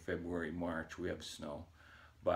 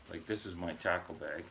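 ordinary talk, nothing marked

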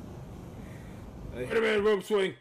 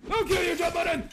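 battle shout; 10 semitones higher, faster than usual. Real, not acted. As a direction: yell it at the boss. Threatening game boss.

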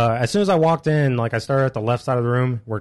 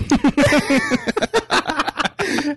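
high helpless laugh; burst of laughter. Real, not acted. Laughing at crossed-off name.

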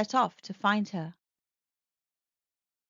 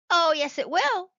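loud insistent reply in unison; all insist together; chorusing; 7 semitones higher, 5 dB louder than usual. A narrator's reading. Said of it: "chorused the robots".

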